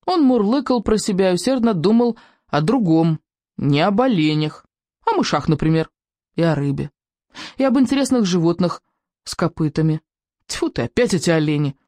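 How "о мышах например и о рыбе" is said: plain reading voice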